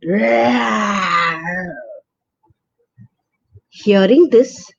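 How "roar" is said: drawn-out growling roar; lioness roar. A narrator's reading. Lionesses waking and roaring at Gail. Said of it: "and they all went".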